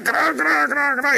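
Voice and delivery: high, soft cra cra like timbers straining; squeaky soft creak sounds; creaking house sound. Retold or imitated. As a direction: imitate the house groaning in the quake. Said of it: "esa casa que hacía cra".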